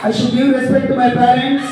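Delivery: slow, drawn-out chanted pledge; slow group recitation. Real, not acted. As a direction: recite the promise word by word. Class reciting a promise together.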